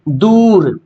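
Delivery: दूर with a long, stretched "oo"; drawn-out vowel. Shown as a demonstration. Teacher showing students a word read stretched, दूर held long. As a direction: draw out the "oo" in दूर. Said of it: "खींच कर पढ़ेंगे".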